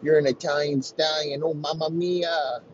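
high-pitched mock Italian exclaiming; mock Italian woman. Woman telling lover she's Italian now, high mock Italian voice. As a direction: lift it high into a hammed-up Italian accent. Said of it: "I'm Italian now".

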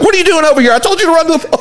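high-pitched mock indignation; imitated berating coach. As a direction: act baffled and annoyed at a player. Coach berates confused player.

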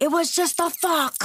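high-pitched mock yell; imitated yell. Retelling the husband's excuse to his upset wife, mock yell. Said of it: "and he's like".

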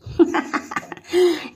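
high, loud cackling laugh; loud cackle. A narrator's reading. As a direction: cackle with delight. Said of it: "greeted her with a loud cackle".

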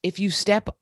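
ordinary talk

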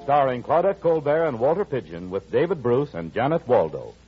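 ordinary delivery

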